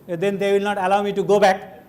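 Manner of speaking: ordinary talk, nothing marked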